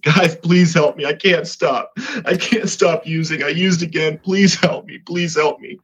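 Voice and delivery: high, urgent plea for help; retold begging. Man on his knees to his Bible study, desperate begging.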